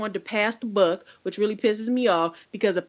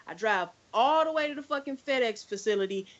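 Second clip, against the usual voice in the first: usual speaking voice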